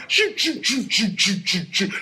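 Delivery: quick repeated record-rewinding noise; rapid-fire vocal scratch sound, falling in pitch; imitated rewind scratch. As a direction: mimic a DJ spinning the record back. Imitating a record being rewound.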